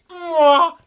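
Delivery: ordinary talk, nothing marked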